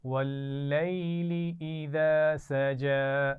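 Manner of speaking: teacher chanting Quran for students to echo; Quranic recitation. Shown as a demonstration. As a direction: model the verse slowly for the class to repeat. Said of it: "एक दफ़ा फिर पढ़ते हैं".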